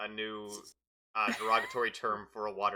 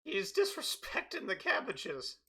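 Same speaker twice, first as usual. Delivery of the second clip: high indignant merchant; 13 semitones higher than usual. Acted.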